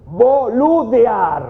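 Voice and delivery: a cheeky stretched-out word to the engineers; drawn-out boludear. Retold or imitated. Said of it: "le digo yo".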